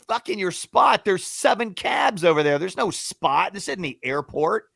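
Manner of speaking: high-pitched indignant rebuttal; retold argument, high then easing down; arguing back. Rider argues back at cabbie about the spot, indignant. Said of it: "yelling at each other".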